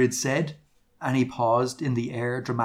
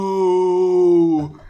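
long, loud, high held "no"; shouted; 5 semitones higher, 6 dB louder than usual. A narrator's reading. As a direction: shout it as one drawn-out cry. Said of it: "he shouted".

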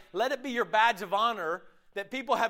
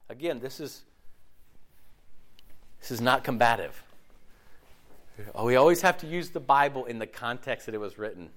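normal talking voice

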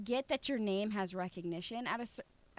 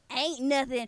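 imitated Southern accent; 4 dB louder than usual. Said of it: "like, in an accent".

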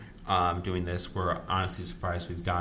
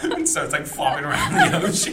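laughing; 13 semitones higher, 5 dB louder than usual. Real, not acted. Laughing while describing.

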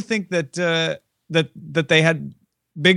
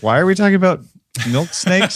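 higher, louder baffled listener; mock bafflement; 6 semitones higher, 4 dB louder than usual. Co-host voicing listeners lost by the tangent, mock bafflement. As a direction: blurt it as a puzzled listener would.

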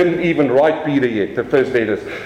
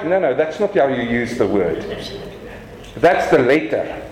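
usual speaking voice

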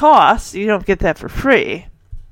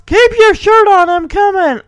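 loud, high retold holler; yelling; 10 semitones higher, 7 dB louder than usual. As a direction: act out a husband yelling back. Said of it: "And he yells".

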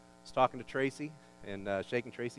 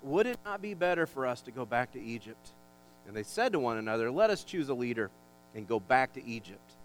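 louder, dramatic Bible reading; scripture reading; 4 semitones higher, 5 dB louder than usual. Acted. Pastor reads Numbers to the church, dramatic reading. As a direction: read the Israelites' grumbling with weight.

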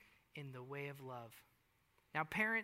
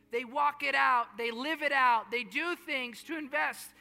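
rising, louder preaching cadence; 5 semitones higher, 8 dB louder than usual. Acted. Pastor urging parents to live out love, preaching loudly. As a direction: drive the point home to the congregation.

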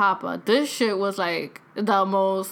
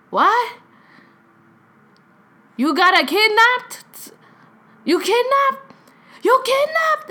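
very high, slightly louder imitated alarm; incredulous question, climbing higher each time; grandfather's shocked disbelief; 12 semitones higher, 5 dB louder, slower than usual. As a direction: imitate a hard-of-hearing grandfather mishearing. Hard-of-hearing grandfather mishears, shocked.

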